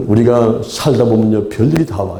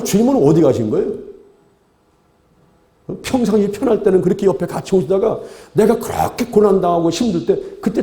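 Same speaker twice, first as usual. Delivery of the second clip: higher-pitched, aggrieved pleading; complaining to the Lord; 7 semitones higher than usual. Acted. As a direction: act out a believer protesting to God. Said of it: "이 사람이 막 불평하고". (주님께 불평하며 따지는 높은 목소리)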